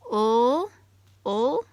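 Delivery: "Ul, ul" sounded out as a drawn-out final L; isolated L sound. Shown as a demonstration. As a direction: say "Ul" slowly as just the L sound. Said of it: "They end in the same sound, L".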